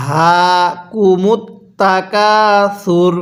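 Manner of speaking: the whole verse drawn out slowly; slow demo recitation. Shown as a demonstration. Teacher recites the verse slowly for students to repeat. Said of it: "আমার সাথে বলুন".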